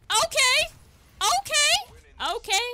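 very high, loud, rising yelps; startled high-pitched okays; squeaky okays. Real, not acted. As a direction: gasp each okay in shock. Reacting to close call.

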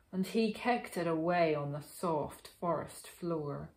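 ordinary delivery, nothing marked

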